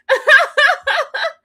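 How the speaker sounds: shrieking burst of laughter; loud high laugh. Real, not acted. Host laughing at Cameron, loud high laugh.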